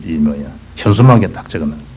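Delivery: usual speaking voice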